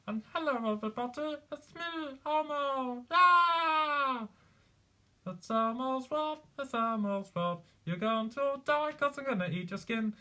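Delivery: high, cheery puppet monster voice; high sing-song kids' puppet turned menacing; Elmo. Shown as a demonstration. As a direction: greet everyone in a high, throaty puppet squeal. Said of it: "I can do Elmo".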